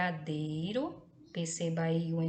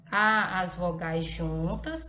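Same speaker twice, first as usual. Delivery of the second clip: "Há as vogais juntas" slow and louder, leaning on "Há"; slow emphasis; 5 dB louder, slower than usual. Shown as a demonstration. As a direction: drive the point home, slowly. Teacher stresses the key point. (ênfase lenta em "Há as vogais juntas")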